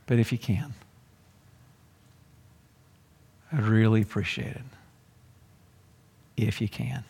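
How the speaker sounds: soft, halting, unsure plea; doubtful father. Acted. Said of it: "Do you hear it in his voice?".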